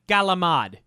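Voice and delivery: "Galamad", said with a hard G and the final vowel dropped; regional pronunciation. Retold or imitated. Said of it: "It's like Galamad".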